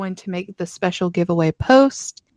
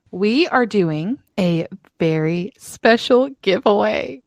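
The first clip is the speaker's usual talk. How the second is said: normal talking voice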